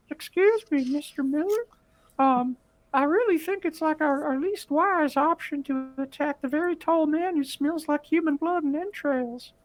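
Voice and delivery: very high, thin little hermit. Acted. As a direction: squeak it out like a tiny old hermit. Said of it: "give my, uh, hermit a voice".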